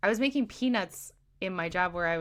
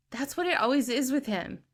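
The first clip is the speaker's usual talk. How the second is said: normal talking voice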